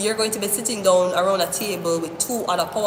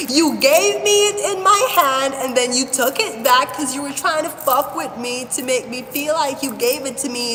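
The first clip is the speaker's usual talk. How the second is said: accusing; 5 semitones higher than usual. Acted. Accusing a card trickster.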